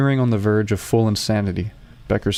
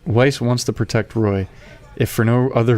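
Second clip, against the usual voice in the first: ordinary talk, nothing marked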